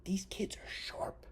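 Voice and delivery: slow, high, breathy emphasis; hushed, slow insistence; intense whisper. Real, not acted. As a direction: lean in and whisper it slowly. Insisting kids are smart.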